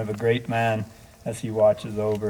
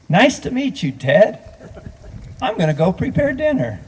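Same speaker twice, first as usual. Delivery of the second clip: higher, friendly quote of Todd; lightly voiced Todd; 8 semitones higher than usual. Eulogist quoting Todd's calm greeting, lightly higher. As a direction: toss it off casually like Todd did.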